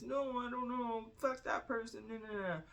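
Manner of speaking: mock stubborn refusal. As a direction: play the grudge-holder, brushing it off. Mock inner voice of holding on.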